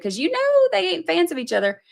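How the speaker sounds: ordinary talk, nothing marked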